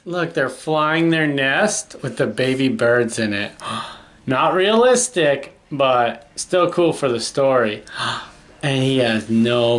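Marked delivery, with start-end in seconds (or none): none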